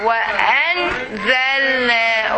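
"Wa, an, zal, na" in separate chunks, pausing between; divided into syllables. Shown as a demonstration. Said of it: "Okay, you can divide here".

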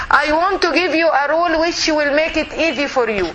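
ordinary talk, nothing marked

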